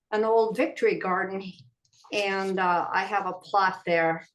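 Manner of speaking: ordinary delivery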